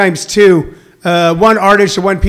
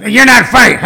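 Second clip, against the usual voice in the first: lightly mocked mean old man, louder; 3 semitones higher, 4 dB louder than usual. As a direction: imitate a grumpy neighbor's put-down. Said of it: "a mean old man".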